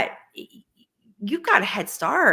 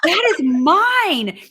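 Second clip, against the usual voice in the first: slow, loud, higher playful claim with that stressed; hammed-up insistence on that; mock possessive; 8 semitones higher, 8 dB louder, much slower than usual. Repeating the claim to Mike, mock possessive and louder.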